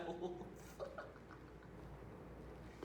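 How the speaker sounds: voiceless, trailing cackle; soft crazed chuckling; unhinged laugh. Acted. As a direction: laugh madly at the cruel thought. Killer laughs mid rant.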